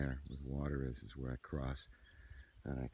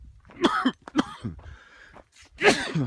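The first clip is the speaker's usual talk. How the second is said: harsh coughing fit; just inhaled a bug; 10 dB louder than usual. Real, not acted. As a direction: cough hard on a bug in the throat. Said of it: "I just inhaled a bug".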